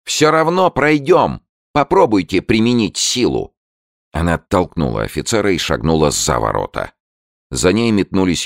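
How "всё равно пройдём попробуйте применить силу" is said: high-pitched acted anger, coming down slightly at the end; angry cry. A narrator's reading. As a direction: cry out angrily, daring them to try. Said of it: "гневно крикнула".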